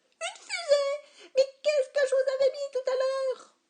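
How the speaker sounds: very high, loud shrieking yell; waitress yelling. A narrator's reading. Waitress yelling back at dad, very high. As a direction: scream back at him. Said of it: "elle a crié".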